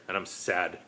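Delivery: plain reading voice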